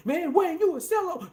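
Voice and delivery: high, loud mocking yell; mock angry fans. Mocking fans yelling at Wayne, high angry shout. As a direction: shout it like an outraged fan. People upset at Wayne, mocked.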